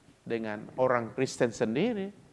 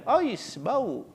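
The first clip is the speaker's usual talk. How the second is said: mock disgusted foreigner, much higher; 9 semitones higher, 3 dB louder than usual. Foreigner handed durian by the preacher's people, mock disgust. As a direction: recoil at the smell. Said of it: "katanya kan".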